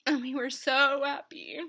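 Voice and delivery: crying through the words; real tears, voice breaking; snotty crying. Real, not acted. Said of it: "oh, now I'm ugly crying".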